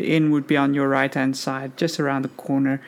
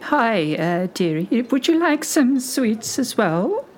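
higher sweet granny voice; very old woman; 5 semitones higher than usual. Acted. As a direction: fawn over the customer like a granny. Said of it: "a very old lady".

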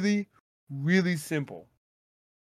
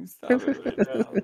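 fake crying; 6 semitones higher than usual. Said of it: "was that better than Amber Heard?".

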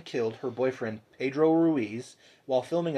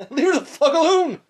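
high, loud yell; indignant outburst; 13 semitones higher, 7 dB louder than usual. Real, not acted. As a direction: shout it at the absent dad. Indignant outburst at the yelling father.

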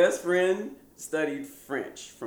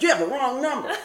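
higher, louder, laughing; curt brush-off; 13 semitones higher, 9 dB louder than usual. Retold or imitated. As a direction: dismiss the caller. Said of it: "I was like".

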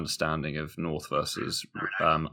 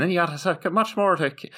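much higher-pitched Irish attempt; bad Irish accent, pitched way up; 10 semitones higher than usual. Shown as a demonstration. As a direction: try Irish by just raising the pitch. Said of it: "I've just changed the pitch".

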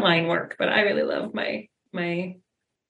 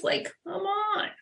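incredulous pleading; 9 semitones higher, 4 dB quieter, slower than usual. Real, not acted. Incredulous at a narrow view.

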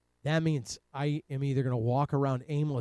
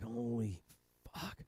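hushed curse; 9 dB quieter, slower than usual. Retold or imitated. Stoned realization, hushed awe.

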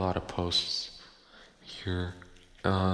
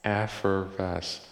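"Effervesce" drawn out slowly; slow practice take of Effervesce; slower than usual. Shown as a demonstration. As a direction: spell out Effervesce syllable by syllable, slowly. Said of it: "Let's do it slow".

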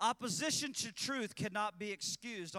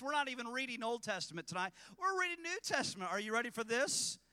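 ordinary talk

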